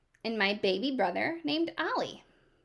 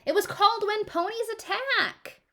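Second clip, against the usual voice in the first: a much higher, louder holler; 13 semitones higher, 5 dB louder than usual. Acted. A girl hollering at her parents about the TV show, high and loud. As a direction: shout it out, pitched way up. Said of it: "I hollered".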